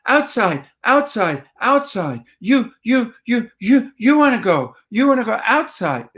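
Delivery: high, short, punchy repeated phrases; repetitive toddlerese. Shown as a demonstration. Parent to toddler at the door, high repetitive toddlerese. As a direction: chant each little phrase to catch their attention. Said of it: "You need short phrases and repetition".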